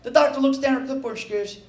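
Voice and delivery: ordinary talk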